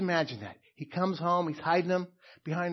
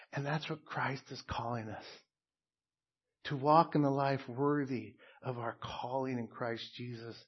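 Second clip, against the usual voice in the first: ordinary talk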